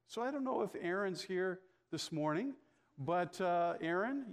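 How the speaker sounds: usual speaking voice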